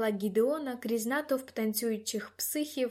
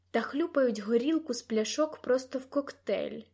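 ordinary delivery

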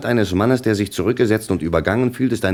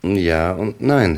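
hesitant, drawn out; 3 dB louder, much slower than usual. Acted. Hesitant answer to major.

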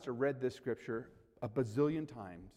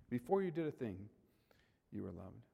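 ordinary talk

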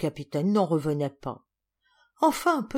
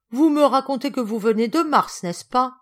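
higher and louder, indignant challenge; outraged; 5 semitones higher, 6 dB louder than usual. Acted. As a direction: call his bluff, scandalized. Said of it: "Madame Trix semblait outrée".

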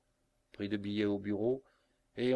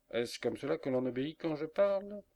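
higher, rising scolding question; indignant scolding; 6 semitones higher, 4 dB louder than usual. A narrator's reading. Faux to Tom the bear who won't come, indignant scolding. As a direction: demand obedience, pitch climbing to the end.

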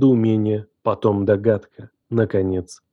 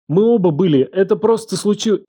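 higher, urgent protest, cut short; flustered excuse; 8 semitones higher than usual. A narrator's reading. He tries to excuse himself to Елена, flustered. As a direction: scramble to justify it.

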